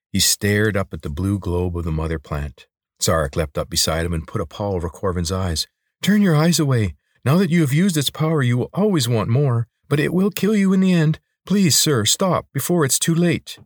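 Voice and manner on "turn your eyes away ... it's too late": high, urgent creature voice; pleading lizard. Acted. Lizard begs sir to stop before too late, pleading. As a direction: implore a master with raised pitch.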